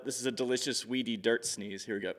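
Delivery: soft, fast muttered aside; quiet aside. Real, not acted. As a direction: drop out of the poem, softly. Quiet aside about the line.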